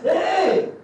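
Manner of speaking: sudden high, loud "Hey!"; angry outburst. Retold or imitated. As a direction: snap like someone whose patience ran out. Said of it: "he just got tired".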